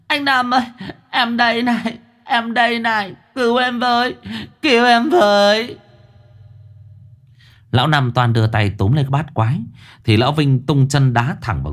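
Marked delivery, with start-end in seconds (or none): 0.1-5.8 high, wailing spirit cries; ghost shrieking. Acted. Trapped ghost Diễm Hường screaming for rescue, high and wailing. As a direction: shriek to be set free. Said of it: "thì rú lên".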